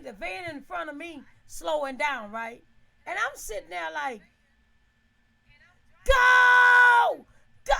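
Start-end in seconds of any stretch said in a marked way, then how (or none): 6.0-7.7 high, loud panicked yell at the van; desperate shouted go; frantic shout. Retold or imitated. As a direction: scream go at the driver. Stuck behind van, yelling.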